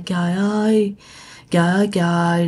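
imitated feverish moaning; mock moaning. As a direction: groan weakly, half-acted. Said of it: "bạn nghe tiếng rên của bà cố".

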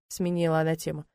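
plain reading voice

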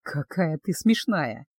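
amused male teasing. Acted. Betrothed fondly teases the indignant witch, low and slow.

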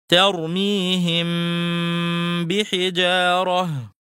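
ترميهم with a long hummed m, lips lightly closed, into بحجارة; very slow, stretch the nasal m before بحجارة; labial concealment. Shown as a demonstration. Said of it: "فتلفظ بلا ضغط قوي على الشفتين".

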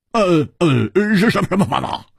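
high, stammering eager question; flustered eagerness. A narrator's reading. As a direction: stumble over the words in impatience. Flustered man begs to know.

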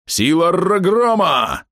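high, slow booming cry; battle cry. Acted. Half-orc bellows a battle cry. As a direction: invoke the god mid-throw.

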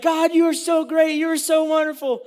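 high, adoring exclamation; gushing praise. Acted. Grateful Israelites praising God, high gushing voice. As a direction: gush with awe, pitched high.

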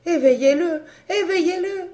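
very high, sobbing, frantic plea; crying out. Acted. Mother begging them to wake her child, high sobbing cry. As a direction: beg through tears, rising. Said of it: "Zeffen criait".

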